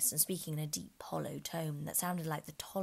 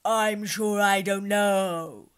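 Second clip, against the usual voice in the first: loud, slow growl; loud slow lion growl; 3 semitones higher, 12 dB louder, much slower than usual. Acted. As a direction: growl it out lazily. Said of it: "the lion growled out".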